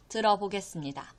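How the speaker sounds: usual speaking voice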